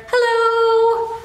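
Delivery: high, sung greeting to a tiny bird; cute hello. Acted. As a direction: coo hello to the cute hummingbird. Greeting the hummingbird.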